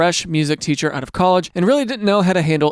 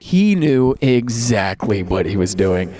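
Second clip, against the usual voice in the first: knowing tone; 9 semitones lower, slower than usual. Retold or imitated. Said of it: "I'm like".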